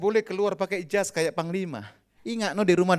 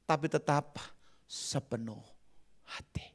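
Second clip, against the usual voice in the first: soft preaching drop; 4 semitones lower, 9 dB quieter than usual. Acted.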